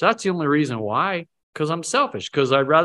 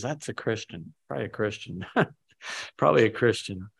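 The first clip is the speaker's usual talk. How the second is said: much softer, musing to himself; quiet inner thought; 2 semitones lower, 6 dB quieter than usual. Retold or imitated. Driver thinking about whoever let him in, softly amused. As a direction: say it under your breath, amused.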